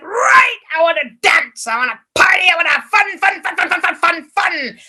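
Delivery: loud, gleeful demands to party; the old man demanding fun from the postie, loud and gleeful; exuberant shout. Acted. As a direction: shout it with wild glee. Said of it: "full of life".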